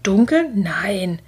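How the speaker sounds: startled question, then a protest; mildly dismayed outcry; calling out. A narrator's reading. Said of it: "rief Simon".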